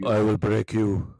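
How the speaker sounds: slow, lightly voiced Russian fighter; a hint of a menacing Russian boxer; Drago impression. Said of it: "That type of guy".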